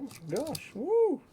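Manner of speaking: sudden high squeal of joy; excited woo. Real, not acted. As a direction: let out a delighted whoop. Spinning toy delights him.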